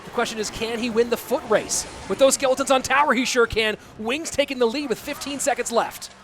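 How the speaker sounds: energetic esports shoutcast; live play-by-play. Real, not acted. Esports caster calling the end of a game, play-by-play. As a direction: call the match live with punchy emphasis.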